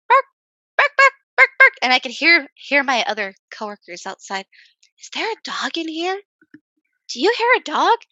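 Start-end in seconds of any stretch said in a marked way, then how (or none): 0.1-1.7 much higher and louder yapping barks; barking dog. Retold or imitated. Waxer imitating the dog barking nonstop in the room, loud high yaps. 5.0-8.0 higher, questioning coworker voices; puzzled coworkers. Retold or imitated. Waxer imitating coworkers outside asking about a dog, puzzled.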